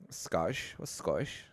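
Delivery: put-on tentative low Scottish accent; hint of Scottish, trying it for size. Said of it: "your Scottish accent".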